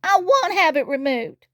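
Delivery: high, mock-sobbing refusal; high-pitched acted sob. A narrator's reading. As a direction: refuse in a tearful little wail. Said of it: "sobbed Tiny".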